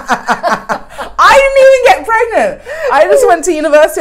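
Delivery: high, loud laughing. Real, not acted. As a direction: laugh through the words. Laughing at her own story.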